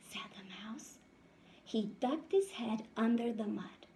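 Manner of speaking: lively storybook read-aloud voice; reading to children. A narrator's reading. Teacher reading a picture book to her class, lively. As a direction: read it out brightly to the little ones.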